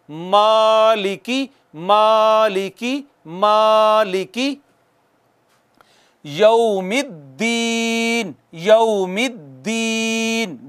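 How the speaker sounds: slow मालिकी यौमिद्दीन, vowels held; smooth long alif. Shown as a demonstration. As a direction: recite smoothly, stretched. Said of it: "एक अलिफ जितना खींचेंगे". (मालिकी यौमिद्दीन, धीरे खींचकर)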